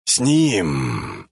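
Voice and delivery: low, throaty growl; guttural growl. A narrator's reading. Modified brother answers Delta while holding a shirt, guttural growl. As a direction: growl it from deep in the gut. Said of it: "утробно прорычал модификант".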